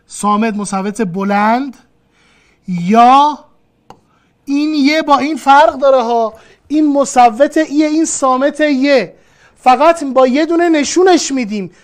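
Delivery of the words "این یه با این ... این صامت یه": ordinary delivery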